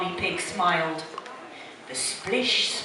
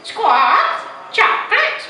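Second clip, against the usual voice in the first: high, loud parrot squawk; piercing bird mimicry; copying parrot; 13 semitones higher, 6 dB louder, slower than usual. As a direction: squawk the word back like Polly. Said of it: "Polly Parrot copied".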